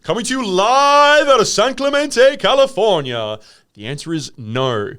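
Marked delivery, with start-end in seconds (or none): 0.0-3.4 high, loud hyped announcer. Pretend. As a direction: boom it like a radio host. Announcer-style intro.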